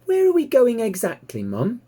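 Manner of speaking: put-on high, eager young piglet asking questions; excited piglet. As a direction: bounce it up high like an eager little pig. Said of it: "said Babe excitedly".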